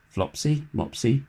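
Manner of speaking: ordinary delivery, nothing marked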